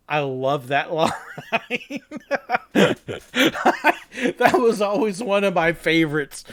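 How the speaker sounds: amused, breaking into laughter; laughing. Real, not acted. Performer enjoying a favourite line, laughing. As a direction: crack up at your own joke.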